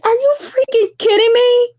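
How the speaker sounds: high-pitched mock outrage; incredulous indignation. Aunt answers nephew doubting her yell, indignant. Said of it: "in the way I yell".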